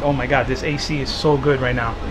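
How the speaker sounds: ordinary talk, nothing marked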